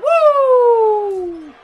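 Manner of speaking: high, loud piercing woo yell; Ric Flair woo. Acted. Commentator cheering a chop with a loud high woo. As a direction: belt out the woo over the chop.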